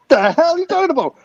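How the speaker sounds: loud, high-pitched mock indignation; acted-out affronted retort; indignant outburst. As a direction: fire back in loud disbelief. Acts out other passenger's indignant reply.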